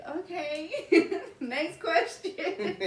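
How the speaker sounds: laughing. Real, not acted. Laughing at awkward moment.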